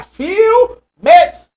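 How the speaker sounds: "Filmes" split as a long, high "fil", gap, then "mes"; teacher chopping "Filmes" in half, high and stretched; separated syllables. Shown as a demonstration. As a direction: pull "Filmes" apart into two loud beats.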